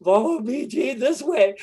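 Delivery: high squeaky talking-dog voice; dog's voice. Pretend. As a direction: play the little dog leading the way. Voicing the guiding dog.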